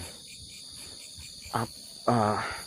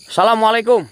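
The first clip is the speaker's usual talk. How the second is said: calling out to spirits; 11 semitones higher, 13 dB louder than usual. Real, not acted. Greeting the spirits.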